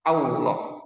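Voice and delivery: Allah said as "auloh", rounded W sound; Allah with a wrong W glide. Shown as a demonstration. Teacher shows wrong example, Allah with W. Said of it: "menyebutnya dengan".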